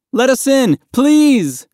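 high, loud plea; desperate begging. A narrator's reading. Boy in the sea begs the people in the dinghy, loud desperate plea. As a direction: beg at the top of your voice. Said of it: "Mahmoud begged".

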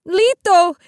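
high, desperate call; piercing, anguished call; crying out. A narrator's reading. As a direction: cry after him over the waves. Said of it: "she cried again".

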